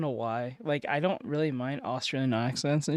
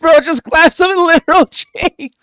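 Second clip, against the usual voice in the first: excited; 10 semitones higher, 13 dB louder than usual. Real, not acted. Reacting to game highlight.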